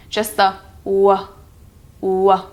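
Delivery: "w" with rounded lips; w sound demo. Shown as a demonstration. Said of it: "like a circular feeling in your mouth".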